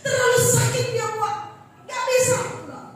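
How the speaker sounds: acted hurt prayer, voice pushed high; pleading with God. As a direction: cry out to God that you can't do it.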